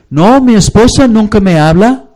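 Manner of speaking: high, loud protest of a neglected husband; indignant husband. Acted. Pastor playing a husband who says his wife never talks, high indignant protest. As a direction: complain like a husband who feels ignored.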